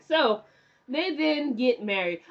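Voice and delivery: ordinary talk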